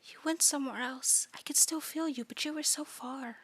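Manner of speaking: soft, whispery eldritch coo; hushed, tender monster voice; eerie doting creature. Acted. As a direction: coo softly and sweetly from the dark.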